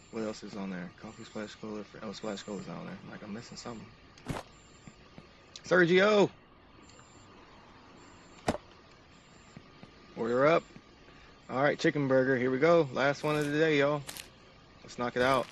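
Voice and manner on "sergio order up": loud high call across the kitchen. Acted. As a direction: shout the order to the cook. Calling the cook again.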